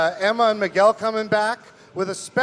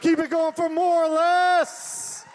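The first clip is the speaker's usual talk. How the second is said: much higher, slow emcee call; hype call; 10 semitones higher, slower than usual. Real, not acted. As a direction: pump up the crowd. Host hypes audience.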